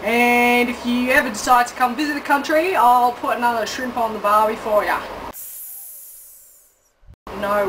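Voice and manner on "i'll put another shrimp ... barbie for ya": normal talking voice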